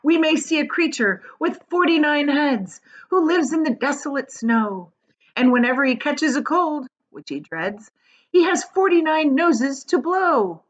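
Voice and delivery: high, loud sing-song verse; reciting a poem. A narrator's reading. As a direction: declaim it like a comic rhyme. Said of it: "he's doing a poem".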